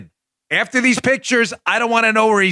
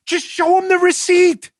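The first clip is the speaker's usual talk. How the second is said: high-pitched, indignant insistence; worked-up, high and emphatic; exasperated demand; 10 semitones higher than usual. Real, not acted. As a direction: burst out in exasperation.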